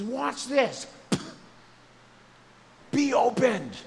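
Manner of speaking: loud, high, slowed "Be opened" as a healing command; commanding proclamation. Retold or imitated. Jesus commands the deaf man, loud proclamation.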